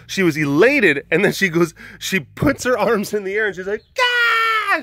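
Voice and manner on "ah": imitated shrill victory scream, much higher; girlfriend's celebratory scream, imitated high; high-pitched scream. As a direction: squeal it like she did. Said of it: "it's a high-pitched scream".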